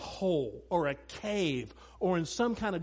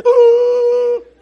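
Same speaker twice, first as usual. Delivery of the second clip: loud, high horrified gasp; shocked gasp; 18 dB louder than usual. Retold or imitated. Parent gasping as daughter throws the gift, loud shocked gasp.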